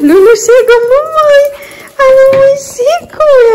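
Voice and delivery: high squeaky excited pet voice; happy dog voice. Pretend. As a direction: speak for the dog, overjoyed.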